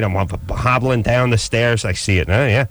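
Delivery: ordinary delivery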